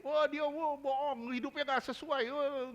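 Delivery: high-pitched mock heckling from a wife; nagging wife. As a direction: imitate a wife carping during the sermon. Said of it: "istrinya sering ngatain".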